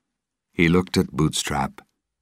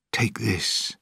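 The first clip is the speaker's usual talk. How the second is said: whisper; 7 semitones higher, 4 dB quieter than usual. Acted. Secret farewell on the ship.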